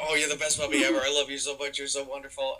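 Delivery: praising a puppy. Retold or imitated. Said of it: "We go".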